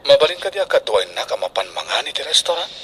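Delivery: soft plea. Acted. Boy pleading with Risa.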